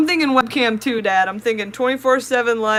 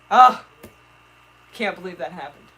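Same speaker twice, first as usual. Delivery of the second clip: normal talking voice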